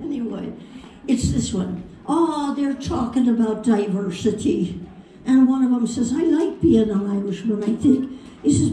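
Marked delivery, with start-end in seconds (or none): 2.1-4.8 storyteller's Irish lilt; Irish accent. Retold or imitated. Telling a joke set in Ireland, Irish accent. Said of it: "this is over in Ireland". 6.1-8.1 put-on Irish accent for a joke character. Irishman chatting with his friends, Irish accent. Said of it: "this is over in Ireland".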